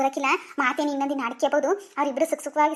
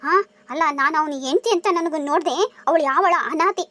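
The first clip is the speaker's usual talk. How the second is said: normal talking voice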